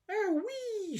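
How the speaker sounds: very high squealing wee; screamed. A narrator's reading. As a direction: squeal with wild delight. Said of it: "she screamed".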